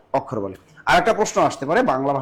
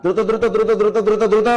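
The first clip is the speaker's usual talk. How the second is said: hurrying; 3 semitones higher than usual. Real, not acted. Teacher hurrying the class along.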